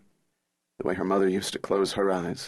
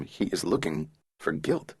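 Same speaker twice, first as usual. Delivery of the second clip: plain reading voice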